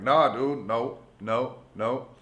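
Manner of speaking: loud, high push-back at the masseur; retold refusal. Telling off the street masseur, loud and high. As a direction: shove the guy off with your voice. Stranger massaging him uninvited.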